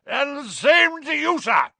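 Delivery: slow, high, full-force knightly roar. A narrator's reading. Portrait knight returning an insult to a boy, roaring. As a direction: roar it back, drawing out every word. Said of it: "roared Sir Cadogan".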